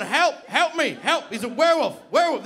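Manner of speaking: high, loud repeated cries; frantic shouting for help; panicked yelling. Acted. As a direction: scream for rescue, hammed up. Yelling for help while attacked.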